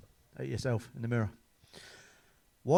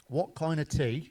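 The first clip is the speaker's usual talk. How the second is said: ordinary talk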